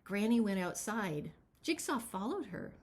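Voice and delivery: usual speaking voice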